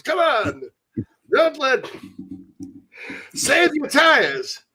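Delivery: put-on high-pitched old propaganda announcer; mock wartime commercial. Host parodies USO commercials, mock wartime announcer. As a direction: urge the home front in a put-on announcer voice. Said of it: "I'm making fun of the commercials".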